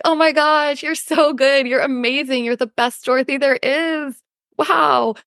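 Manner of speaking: high-pitched pet-praise voice turned on yourself; gushing self-praise. Acted. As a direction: adore yourself like a favourite dog. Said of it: "as kindly as we talk to our pets".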